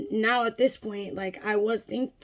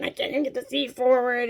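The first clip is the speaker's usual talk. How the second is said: usual speaking voice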